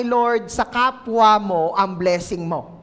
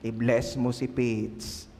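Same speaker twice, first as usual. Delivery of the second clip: low, soft, slow voice of God; God's voice; 13 semitones lower, 9 dB quieter, slower than usual. Retold or imitated. Pastor voicing God calmly speaking to a sister. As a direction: speak as a gentle deep Lord. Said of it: "Ano nga po ulit sabi niyo?".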